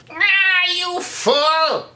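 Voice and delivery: very high villain cry, drawn out; Skeletor. Shown as a demonstration. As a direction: stretch the nah into a high cartoon villain voice. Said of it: "who Skeletor is".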